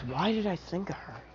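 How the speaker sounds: very soft murmur to himself; quiet self-questioning. Acted. Izuku pauses mid-thought about his past, quiet self-questioning. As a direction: drop right down into private thought. Character thinks to himself after pausing.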